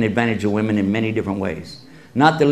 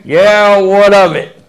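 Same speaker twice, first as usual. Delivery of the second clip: defiant retort; 6 semitones higher, 8 dB louder, slower than usual. Retold or imitated. Woman snaps back at speaker.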